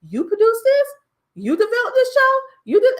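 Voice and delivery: high, loud surprised asks; voicing people shocked she produced it; astonished questions. People who learn she produced the show, high and astonished.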